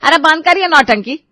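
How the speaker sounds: loud, high-pitched cut-in; angry outburst. Acted. Asha halts daughter-in-law's show of concern, angrily. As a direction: shut the act down angrily. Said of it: "इतना गुस्सा कर रही हो".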